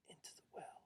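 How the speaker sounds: barely whispered, ominous ending; hushed. A narrator's reading. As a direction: let it sink to a whisper. Hushed end of climax.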